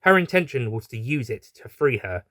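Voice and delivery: usual speaking voice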